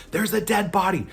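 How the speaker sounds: high, loud panicked shriek, re-enacted; screaming bloody murder. Teenager alerting dad's group to a body, panicked scream. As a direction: shriek it over and over in mock terror. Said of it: "screaming bloody murder".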